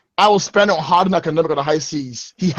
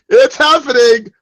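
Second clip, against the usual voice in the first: higher, louder, slowed for drama; hyped; 6 semitones higher, 6 dB louder than usual. Real, not acted. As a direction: savour the big moment. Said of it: "I'm about to make a deal".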